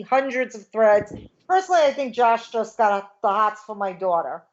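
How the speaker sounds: normal talking voice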